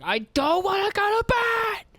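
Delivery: very high, slow wailing protest; toddler tantrum. Retold or imitated. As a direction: imitate a kid refusing bedtime. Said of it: "he was like".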